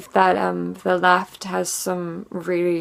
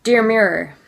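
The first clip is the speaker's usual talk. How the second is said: loud, raised, declamatory slam poet delivery; angry slam poetry; 3 semitones higher, 7 dB louder than usual. Acted. Feminist slam poet addresses her mirror, angry slam recital. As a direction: hurl each phrase at the mirror, falling hard at the ends.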